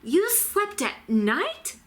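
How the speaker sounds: high, slow, shocked gasp; a stunned bat, drawn out; high-pitched disbelieving bat. A narrator's reading. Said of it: "gasped another".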